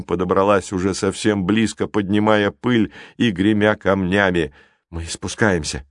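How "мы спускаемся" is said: usual speaking voice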